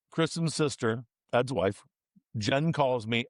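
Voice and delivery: ordinary delivery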